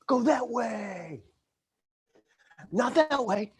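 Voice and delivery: high, drawn-out mock directing call; parking guy calling. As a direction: stretch the words as if waving cars along.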